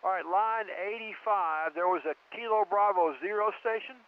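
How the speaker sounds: distant radio transmission; crackly military radio. Real, not acted. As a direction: voice over a radio link. Net logger over radio.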